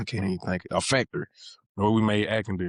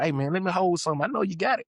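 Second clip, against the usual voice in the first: playful slang ask, fast and rising higher at the end; joking street slang; 7 semitones higher, faster than usual. Retold or imitated. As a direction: hit up a buddy for money the way we joke around. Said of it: "just playing and joking like how we talk".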